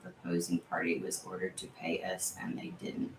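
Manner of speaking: soft unvoiced whisper of the message; whispered rereading. Real, not acted. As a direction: mouth the message quietly to yourself.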